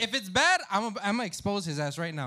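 loud playful indignation; loud mock outrage.

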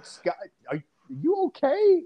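high, slow, concerned mock voice; Michael Jordan. Imitating a star checking on his ranting teammate, high concern. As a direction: check on him slowly in a high, puzzled voice. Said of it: "Mike's just sitting there going".

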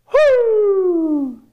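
long high "whoo" sliding down; falling whoop. Acted. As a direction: whoop like someone plummeting. Acts out falling off the building.

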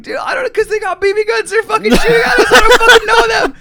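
frantic shouting. Retold or imitated. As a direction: protest in escalating panic. Frantic kid answering shouting police.

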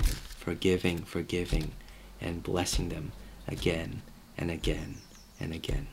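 soft, low "forgiving", repeated tenderly; gentle repetition. Shown as a demonstration. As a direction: let each "again" fall softly and low, unhurried. Gentle contrast to the repeated complaining.

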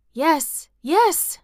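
ordinary delivery

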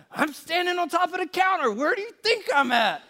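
high, exasperated mock wife. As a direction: squeal it from up on the counter. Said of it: "the urgency that she had in her voice".

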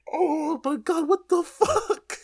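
very high, slow, drawn-out what the fuck; reacting to a gross anime twist; stunned disbelief. Real, not acted. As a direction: let it rise into a squeak of disbelief. Shocked reaction to the scene.

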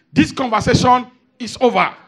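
loud, curt mock dismissal; dismissive man. Preacher imitating men ending a conversation, loud and curt. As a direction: shut the talk down hard. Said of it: "you hear the men say".

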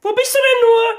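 high, anxious mother voice; searching desperately for her child; worried mother. Acted.

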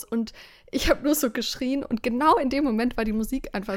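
ordinary delivery

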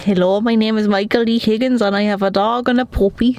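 usual speaking voice

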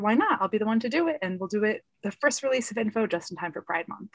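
usual speaking voice

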